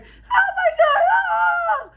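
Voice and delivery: loud, slow, high-pitched imitation shriek; hammed-up shrieking panic; mock freak-out scream. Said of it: "She really freaking the fuck out".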